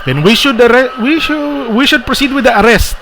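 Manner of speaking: high-pitched knight character voice; knight urging arrest. Acted. Knight urges the others to arrest, high and insistent. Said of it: "this knight says".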